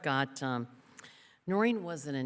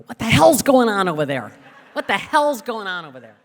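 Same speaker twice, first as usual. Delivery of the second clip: loud, higher angry bellow; dad yelling; 7 semitones higher, 15 dB louder than usual. Retold or imitated. Dad yelling down the phone at the girls. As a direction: roar it like a father fed up. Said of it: "my dad would go".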